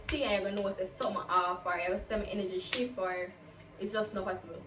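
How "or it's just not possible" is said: ordinary talk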